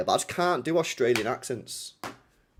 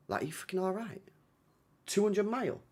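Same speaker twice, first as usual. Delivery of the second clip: high, disbelieving questions; can't believe it; incredulous; 8 semitones higher than usual. Real, not acted. Disbelief at 200 mile run.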